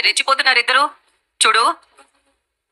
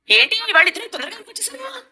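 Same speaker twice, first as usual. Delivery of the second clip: pointed, biting question, a bit higher; sharp; 8 semitones higher, 3 dB quieter than usual. Real, not acted. Mother-in-law questioning the late couple, sharply. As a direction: ask it with a sting. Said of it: "అంత ఘాటుగా అడిగావు".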